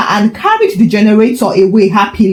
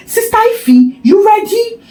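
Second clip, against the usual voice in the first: much higher, eager neighbour voice; a scheming auntie checking in, pitched up high; conspiratorial old woman; 11 semitones higher than usual. Acted. Mama Bose whispering at door.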